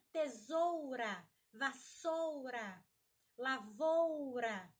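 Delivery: "tesoura, vassoura, lavoura" with long, strong "ou"; slow teacher demo stressing "zou", "ssou", "vou"; stronger stressed syllable. Shown as a demonstration. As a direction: push and stretch "ou" in each word. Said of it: "Aquilo que nós falamos com mais força".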